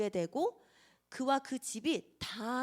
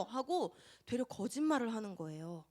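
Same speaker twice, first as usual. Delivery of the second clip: ordinary talk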